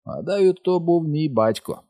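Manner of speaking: ordinary delivery, nothing marked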